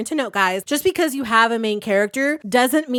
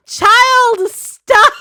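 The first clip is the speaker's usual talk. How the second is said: sharp yell; 12 semitones higher, 9 dB louder than usual. Acted. Voicing an adult scolding the child.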